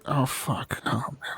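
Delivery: breathless whispered oh fuck under his breath; whispered curse. Real, not acted. Host realizing he lost the patron list, whispered curse. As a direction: swear quietly to yourself off-mic.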